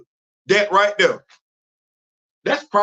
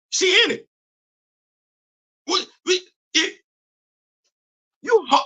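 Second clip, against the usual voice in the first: incredulous squeak; 10 semitones higher than usual. Real, not acted. Incredulous at fans.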